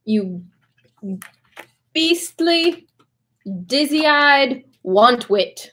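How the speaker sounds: high, very slow, drawn-out insult; theatrical insult. Pretend. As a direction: savour each word like a Shakespearean insult. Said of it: "So one insult for the road".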